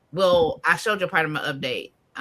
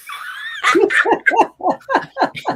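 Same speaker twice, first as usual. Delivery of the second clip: high, loud burst of laughter; loud amused cackle; big laugh; 8 dB louder than usual. Real, not acted. Laughing at her own digging joke.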